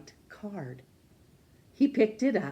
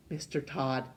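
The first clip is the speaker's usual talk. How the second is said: ordinary delivery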